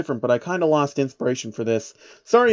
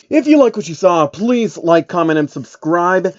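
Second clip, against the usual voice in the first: louder, upbeat sign-off plea; outro pitch; 4 semitones higher, 4 dB louder than usual. Acted. YouTuber asking viewers to like and subscribe, louder upbeat outro. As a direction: push the like-and-subscribe call out brightly.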